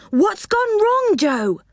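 high, drawn-out anxious question; alarmed cry. A narrator's reading. Rick asks Joe about the runaway train, alarmed. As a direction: ask it high-pitched, in alarm.

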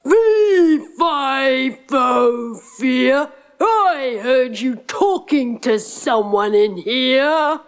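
menacing giant sniffing out intruders; booming giant. Acted. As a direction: giant threatening the prince. Giant bursts in.

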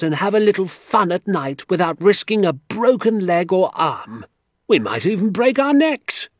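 high-pitched, loud indignation; outraged rant. A narrator's reading. Doctor telling father what the traps mean, outraged. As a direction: keep raging, hammering out each point. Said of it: "jumping up and down with fury".

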